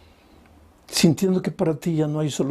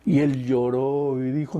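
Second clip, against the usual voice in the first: ordinary talk, nothing marked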